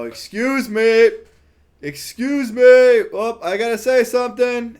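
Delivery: high, loud call to get a crowd's attention; shouting over a tent full of people to be heard; calling out loudly. Retold or imitated. Narrator calling out to a crowded tent, loud and high.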